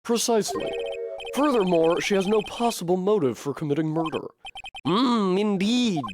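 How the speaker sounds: a hint of a gullible old man; doddering judge.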